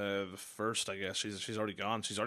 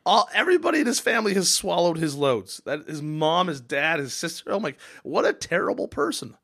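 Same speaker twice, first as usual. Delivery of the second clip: disgusted outburst; 6 semitones higher, 7 dB louder than usual. Real, not acted. Disgusted outburst about story.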